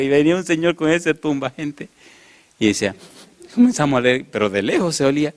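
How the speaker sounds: ordinary talk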